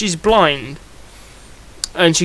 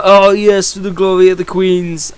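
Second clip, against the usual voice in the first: a hammed-up, louder Russian accent, deliberately bad; shitty Russian accent; 2 semitones higher, 5 dB louder than usual. Commentator voicing the pushover villain Vor in a bad Russian accent. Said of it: "in a shitty Russian accent".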